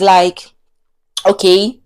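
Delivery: usual speaking voice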